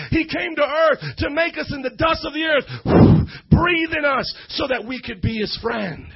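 loud, emphatic preacher voice calling to the congregation; preaching at full force. Acted. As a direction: preach it out to the whole crowd.

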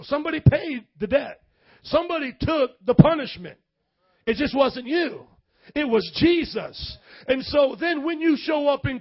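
loud, rising preacher's declaration; preaching at full force. Acted. Preacher to the congregation, loud and emphatic. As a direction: drive it home to the congregation.

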